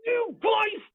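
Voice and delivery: high, loud mock scolding; hammed-up high shout at the people; exasperated prophet. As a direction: tell the people off like a fed-up prophet. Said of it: "it's the prophets being like".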